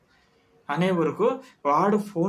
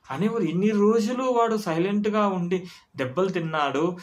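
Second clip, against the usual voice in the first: ordinary talk, nothing marked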